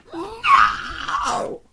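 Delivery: high, loud howled refusal; screaming little kid. Retold or imitated. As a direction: howl it like a tantruming child. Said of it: "screaming like a little kid".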